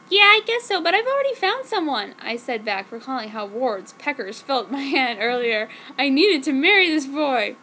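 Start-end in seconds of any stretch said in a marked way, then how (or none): 0.1-2.1 put-on high, louder teen girl voice; girly heroine voice. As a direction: play the gushing teenage heroine. Narrator voices herself in quotes.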